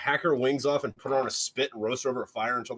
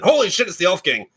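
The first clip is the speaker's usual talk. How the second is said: loud, higher-pitched acted alarm; shocked outcry; 6 semitones higher, 9 dB louder than usual. Dad finding the Elf King, shocked. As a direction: exclaim it like a startled dad.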